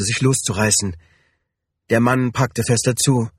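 usual speaking voice

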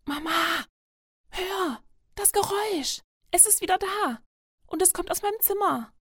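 put-on high-pitched, urgent child's voice; alarmed plea in a high little voice; scared child. A narrator's reading. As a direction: urgently alert Mama to a frightening noise. Scared child wakes mother at night.

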